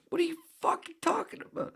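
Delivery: high, loud furious shout; furious yell. Retold or imitated. Boy screaming at the umpire, furious. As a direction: scream it in outrage.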